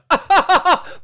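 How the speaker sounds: high, loud fake laugh; scornful burst of laughter; mock guffaw. Nintendo hearing the Atari plan, loud mocking laugh.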